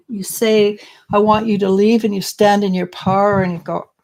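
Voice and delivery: normal talking voice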